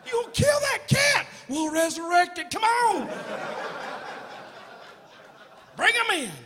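high, loud calling out; hammed-up baptism fervor; mock revival shouting. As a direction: holler like kids playing church. Children playing church baptism, calling out.

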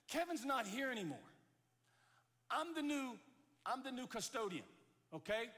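high, emphatic acted announcement; brusque custodian. New custodian answers the swimmer.

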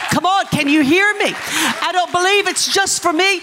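high, urgent preacher's cry; fervent preaching. Real, not acted. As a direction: press it on the congregation with conviction. I feel this strong.

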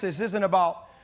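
usual speaking voice

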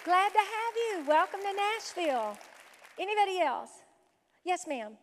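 ordinary talk

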